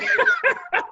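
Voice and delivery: high giggling burst; laugh. Real, not acted. Guest hears the childhood TV noise, laughing. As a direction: laugh in recognition.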